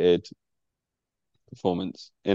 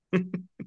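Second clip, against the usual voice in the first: short uneasy laugh; nervous laugh; 9 semitones higher, 7 dB quieter than usual. Real, not acted. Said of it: "It's a nervous laugh".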